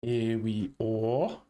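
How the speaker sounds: Here we are, stretched out very slowly; slow drawn-out repeat. Real, not acted. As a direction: drag out each word, resigned. Repeats phrase, second drawn out.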